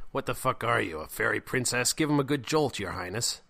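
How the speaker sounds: loud, derisive jeer; mocking scorn. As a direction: taunt a timid friend into action. Eric snorts and mocks Jack.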